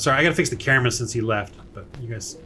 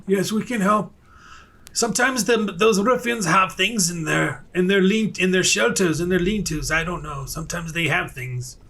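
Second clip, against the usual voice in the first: higher, hesitant villager, voice apart from own; 4 semitones higher than usual. Acted. Game master voices villager describing ruffians' shelters, higher and hesitant. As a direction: stammer through it as an unsure local.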